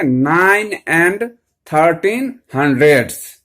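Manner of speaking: very slow, drawn-out demonstration; पढ़कर दिखाना. Shown as a demonstration. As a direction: hold each word long for the class. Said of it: "तो क्या करेंगे". (शब्दों को खींचकर धीरे पढ़ना)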